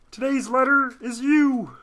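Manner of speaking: high, slow, loud frog voice; Kermit impression. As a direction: announce it like a puppet host. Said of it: "Kermit the Frog here".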